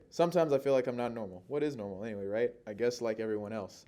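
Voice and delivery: loud, quick run-through of the lines; hurried rehearsal. Real, not acted. Actor rehearsing a monologue as time runs out, hurried. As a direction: rattle it off loudly against the clock. Timed rehearsal, seconds running out.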